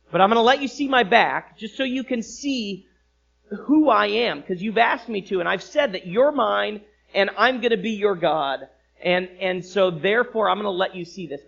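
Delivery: high, loud preaching of God's words; God's promise preached out loud; preaching at full force. Acted.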